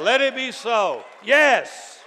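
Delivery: loud, high emphatic affirmation; shouting back to God. Acted. Believer saying amen to God's promises, shouting loudly. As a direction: drive each phrase out as a shout. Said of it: "That's what we shout back to God".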